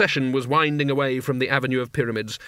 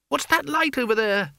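very high character voice, curious; high, lilting young woman; Isabel's girlish voice; 11 semitones higher than usual. Acted. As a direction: pitch it way up for the question. Narrator voices Isabel asking a question.

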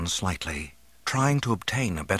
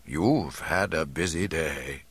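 put-on slow, lower old man's voice; soothing old man; 4 semitones lower, slower than usual. A narrator's reading. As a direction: settle a patient down, taking your time. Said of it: "the man instructed him".

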